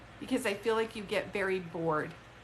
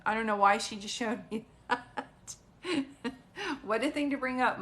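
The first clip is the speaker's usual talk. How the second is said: normal talking voice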